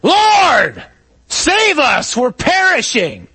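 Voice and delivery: high, loud shout over a roaring storm; panicked yell. Acted. Disciple yelling to Jesus in the storm, panicked yell. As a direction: yell desperately to the far end of the boat. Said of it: "one of us yells to the back of the boat".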